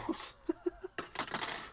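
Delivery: soft, high giggle; light, hushed chuckle; amused laugh. Real, not acted. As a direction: quietly laugh at yourself. Laughs at repeating own words.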